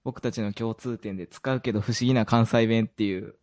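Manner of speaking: normal talking voice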